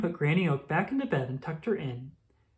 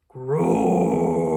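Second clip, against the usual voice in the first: bear roar; 11 semitones lower, 7 dB louder than usual. A narrator's reading.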